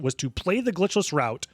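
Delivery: normal talking voice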